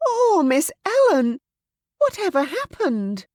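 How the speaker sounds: high, loud, panicked lament; frightened cry. Acted. Susan kneeling by Ellen, frightened. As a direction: cry out in fear for her.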